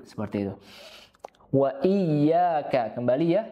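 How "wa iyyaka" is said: "Wa iyyaka" slow, double y held. Shown as a demonstration. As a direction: hold the y of "iyyaka". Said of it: "tasydidnya diperhatikan".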